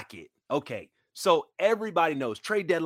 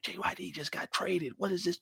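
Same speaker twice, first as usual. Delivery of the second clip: whispering teammates; 6 semitones higher, 11 dB quieter than usual. Retold or imitated. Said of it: "whispering in the back".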